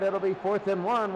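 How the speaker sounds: normal talking voice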